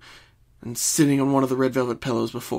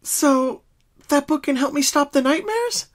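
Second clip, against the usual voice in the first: higher, hopeful question; 14 semitones higher, 4 dB louder than usual. Acted. Pinkie asks Twilight about the book, hopeful. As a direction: let hope creep in. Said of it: "hope rising in her heart".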